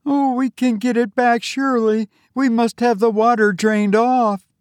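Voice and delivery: put-on high, upset girl's voice; distressed young woman. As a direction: lament it in a very high girlish pitch. Voices Olive urging recovery.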